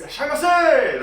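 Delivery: loud, high sushi chef welcome; sushi chef shout. Retold or imitated. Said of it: "The man voice".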